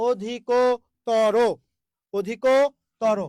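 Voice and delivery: অধিকতর in very slow syllables, "o-dhi-kooo... to-ro"; slow pronunciation demo. Shown as a demonstration. Teacher checking that students understood, অধিকতর slow and syllabic. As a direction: pull অধিকতর apart with a long ko. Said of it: "বুঝতেই পেরেছেন?".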